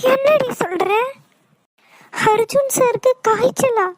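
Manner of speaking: taken aback, voice jumping up; alarmed surprise. Acted. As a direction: react with alarm to bad news. Reacts to news of his fever.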